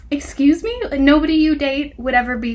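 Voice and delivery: sharp disbelieving "excuse me"; incredulous. Real, not acted. Reader reacting to a cringey book line, incredulous. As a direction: call out the absurd line in disbelief.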